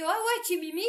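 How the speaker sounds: lightly voiced sleazy compliment; mock chat-up. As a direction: parody a smooth guy. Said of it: "quand tu dis à une fille".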